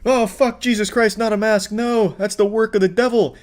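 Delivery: high-pitched sarcastic outcry of dread; mock horror. Mocking anti-masker's dread of masks.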